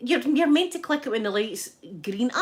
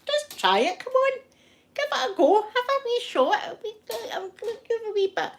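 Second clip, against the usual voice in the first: much higher, eager wheedling; coaxing; 13 semitones higher than usual. Retold or imitated. She coaxes her ex-husband to try the gas and air, high and eager. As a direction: egg him on, pitched way up.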